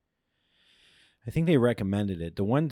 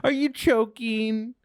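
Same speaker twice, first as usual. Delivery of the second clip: mock sympathy; 14 semitones higher, much slower than usual. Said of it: "Somebody goes".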